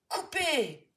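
loud, high command to stop; ordered. A narrator's reading. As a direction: cut the scene with authority. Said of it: "ordonne le réalisateur".

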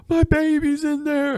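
put-on high, slow plea for her baby; hammed-up wail of a mother; mock distraught mother. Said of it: "one of them's like".

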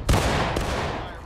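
loud unvoiced battle cry; shouting fire. Real, not acted. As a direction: roar the order.

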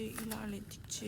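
usual speaking voice

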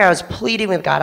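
ordinary delivery

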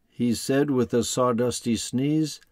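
lively rhyming storybook reading; reading to children. A narrator's reading. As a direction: read it like a bedtime picture book. Narration between Lorax lines.

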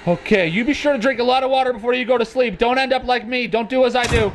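high-pitched, urgent cautionary advice; mock advice.

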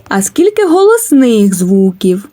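ordinary talk, nothing marked

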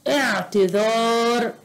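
اعتذار slowly with a long last vowel; drawn-out word. Shown as a demonstration. Teacher helping pupils find a letter, slowly stretched اعتذار.

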